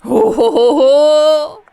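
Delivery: booming high ho ho ho; big loud mocking laugh; loud cackle. Ghost vanishes, loud cackle.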